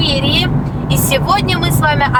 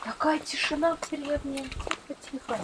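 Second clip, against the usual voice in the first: hushed whisper in a silent village; 17 dB quieter than usual. Real, not acted. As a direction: drop to a breathy hush. Quiet village, hushing herself.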